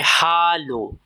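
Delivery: हालो with a clear aspirated h and open a; German Hallo. Shown as a demonstration. Teacher modelling हालो for beginners, clear h. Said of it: "इसकी pronunciation है हालो".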